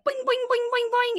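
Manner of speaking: high, springy boing for a bouncing puppy; bouncy boing noises. Retold or imitated. Telling her friend about the bouncing runt puppy, high boing sounds. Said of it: "one was like".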